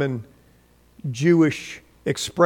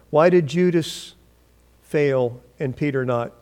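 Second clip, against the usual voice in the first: ordinary talk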